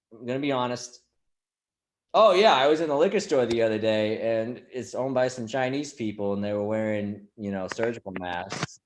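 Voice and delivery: ordinary talk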